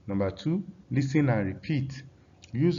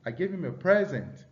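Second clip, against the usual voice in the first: front-stressed present in the sentence; stress on first syllable; 6 semitones higher than usual. Shown as a demonstration. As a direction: present as noun, PRES-ent, raised pitch. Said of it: "That's a noun".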